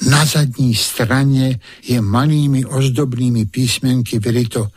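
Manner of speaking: plain reading voice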